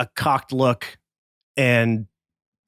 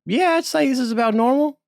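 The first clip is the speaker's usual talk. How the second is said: much higher, evasive squeak; high squeaky bartender; 10 semitones higher, faster than usual. Acted. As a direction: squeak it out, caught in a lie. Nervous bartender.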